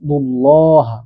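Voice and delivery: "দুল্লাহা" with a rounded "dul-LAW-ha"; heavy "lo". Shown as a demonstration. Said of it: "পেশ থাকলে ল হবে".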